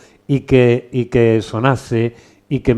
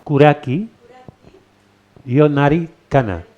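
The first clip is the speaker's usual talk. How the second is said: slow, higher, lilting Japanese verse; slow haiku recitation; 4 semitones higher, 3 dB louder than usual. Shown as a demonstration. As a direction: recite the poem slowly and clearly. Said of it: "repítemelo otra vez".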